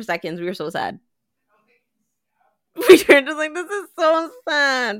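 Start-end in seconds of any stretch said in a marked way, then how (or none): 2.9-5.0 mock tearful. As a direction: complain as if on the verge of tears. Retelling a mock-tearful group complaint.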